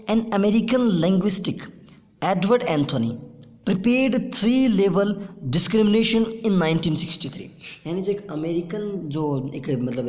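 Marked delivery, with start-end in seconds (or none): 0.1-3.2 dictation. Shown as a demonstration. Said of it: "dictating to students". 3.7-7.5 very slow, loud and higher, word by word; slow dictation. Shown as a demonstration. Teacher dictating notes to the class, slow and loud. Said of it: "dictating notes to the class".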